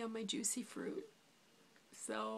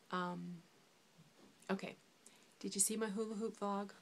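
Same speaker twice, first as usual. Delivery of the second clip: talking while chewing gum; words around a wad of gum; 4 dB quieter than usual. Real, not acted. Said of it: "I'm sorry for the gum chewing".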